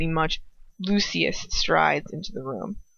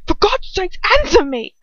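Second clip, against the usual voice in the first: higher, louder, shaking demand; 11 semitones higher, 7 dB louder than usual. A narrator's reading. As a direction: force an answer out of her. Lucius shaking her for answer.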